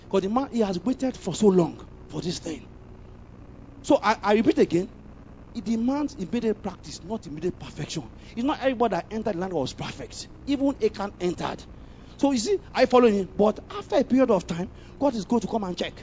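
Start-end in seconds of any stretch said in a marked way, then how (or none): none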